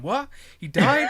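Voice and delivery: high, loud, laughing shocked receptionist; woman answering the phone. Retold or imitated. Receptionist hearing on the phone a henchman died, laughing shocked squeal. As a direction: squeal it out like a stunned secretary on the phone. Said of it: "some woman answering the phone and going".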